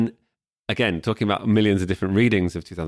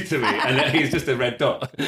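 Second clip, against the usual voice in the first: laughing; 5 semitones higher than usual. Real, not acted. Laughing at own story.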